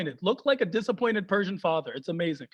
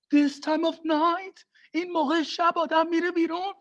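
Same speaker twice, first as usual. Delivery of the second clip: higher, disapproving dad; mock stern dad; 6 semitones higher, faster than usual. Dad scolds son for going out at night, mock shocked.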